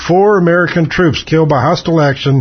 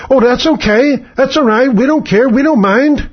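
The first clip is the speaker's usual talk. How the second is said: much higher, whiny pushover voice; mock meek acceptance; 7 semitones higher than usual. Host mocking voters who shrug off dead soldiers, high whiny voice. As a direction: sound like a spineless shrug. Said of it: "we say".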